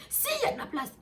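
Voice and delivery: high, insistent contradiction; retold shouted retort. Passenger insists to the narrator there is room, mock shouting. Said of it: "Elle commence à me crier dessus".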